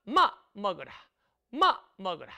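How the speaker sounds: "마 먹으라" in a Jeju mother's voice, clipped fall; lightly voiced Jeju mom calling to eat; hint of Jeju dialect, falling tone. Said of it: "제주도 어머니 밥 주실 때 어떻게 말합니까?".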